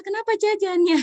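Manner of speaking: high, loud parental protest; mock exasperation. Parent reacting to child wanting snacks, mock exasperation. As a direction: imitate an exasperated parent questioning. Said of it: "Yang kita respon adalah".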